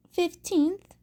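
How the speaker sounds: usual speaking voice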